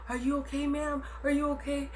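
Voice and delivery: a hint of an anxious security guard checking on her; softly voiced guard; concerned guard. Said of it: "I got security guards".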